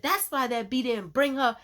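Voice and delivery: high, loud, furious accusation; angry yelling. Retold or imitated. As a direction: shout it after the girl walking away. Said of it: "So she started yelling".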